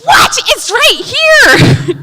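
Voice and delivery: high, loud, slow mock-indignant shout; hammed-up outrage at the email. Wife insisting to husband, mock indignant shout. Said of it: "retelling her reply to husband".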